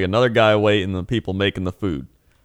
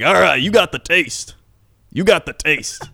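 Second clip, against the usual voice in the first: put-on old trucker; 7 semitones higher, 4 dB louder than usual. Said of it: "he's like".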